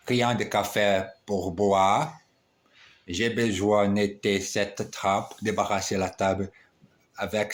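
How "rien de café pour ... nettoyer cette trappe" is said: usual speaking voice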